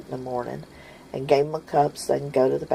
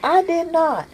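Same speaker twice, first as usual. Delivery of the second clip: put-on indignant boy; 14 semitones higher, 4 dB louder than usual. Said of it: "It's like".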